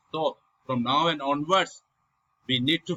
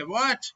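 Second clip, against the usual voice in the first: "What" swept upward to a high pitch; high questioning "What"; rising tone; 7 semitones higher than usual. Shown as a demonstration. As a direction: ask "What" with a steep rise. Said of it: "then you speak ma, like, "What?".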